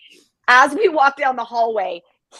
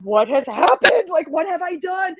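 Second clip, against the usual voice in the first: re-enacted distraught questioning; retold hysterics; 6 semitones higher than usual. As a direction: wail it in mock despair. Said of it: "hysterically started crying".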